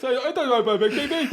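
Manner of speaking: high, loud taunting parrot of his line with a laugh; teasing mimicry; mocking repeat. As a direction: throw his own words back at him.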